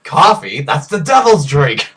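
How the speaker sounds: hammed-up disgust at coffee; indignant movie voice. Acted movie scene reply.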